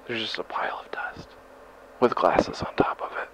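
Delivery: hushed, spooked whisper. Acted. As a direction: whisper it, unnerved. Finding the pile of dust.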